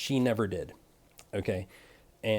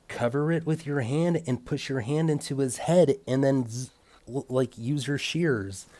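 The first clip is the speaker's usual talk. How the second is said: irritated instruction; 3 semitones higher, 4 dB louder than usual. Retold or imitated. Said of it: "I could detect some irritation from him".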